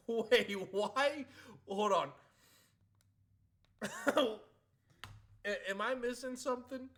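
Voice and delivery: loud, high, laughing bewilderment; loudly and laughing, baffled by the ban; excited disbelief. Real, not acted.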